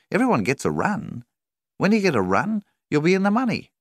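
butter him up with a high, honeyed tone; very nicely. A narrator's reading. Banker coaxing a struggling farmer, high and sugary. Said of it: "He said it all very nicely".

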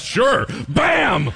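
loud high bark of a sudden hit; mock punch shout. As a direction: slam it out like a punch landing. Other guy punches prophet.

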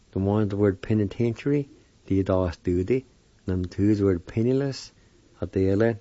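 ordinary talk

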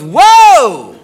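loud, drawn-out mock whoa; startled exclamation. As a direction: exclaim in exaggerated alarm. Girlfriend's startled exclamation, retold.

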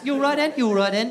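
British greeting with words run together; fast British accent. Acted. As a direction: rattle it off like a Brit. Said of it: "It's, like, very fast".

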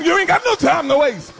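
ordinary talk

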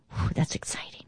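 breathy whisper of delight; whispered aside. Real, not acted. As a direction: whisper it to yourself, thrilled. Aside while reading scripture.

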